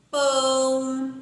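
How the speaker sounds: pão with a nasal ão, mouth barely open; pão said through the nose. Shown as a demonstration. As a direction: let the ão sound ring through the nose. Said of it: "Eu não abro muito a minha boca pra falar".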